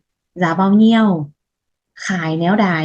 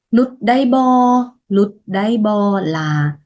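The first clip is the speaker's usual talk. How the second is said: normal talking voice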